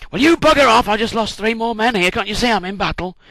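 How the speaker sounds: angry retort. Retold or imitated. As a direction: tell them to clear off, furious.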